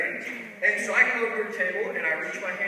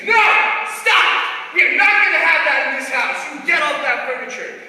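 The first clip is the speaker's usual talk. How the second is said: high, loud scolding shout; scolding yell; 7 semitones higher, 9 dB louder than usual. Retold or imitated. Manager yelling at her niece on the furniture, loud. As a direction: yell at the kid on the sofa. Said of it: "And she's like".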